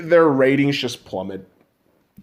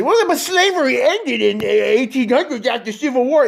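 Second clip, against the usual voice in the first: higher, whiny know-it-all voice; mocking listener; 10 semitones higher than usual. Mocking listeners who say slavery ended, whiny and smug. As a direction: mimic a smug doubter. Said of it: "dumb motherfuckers will say".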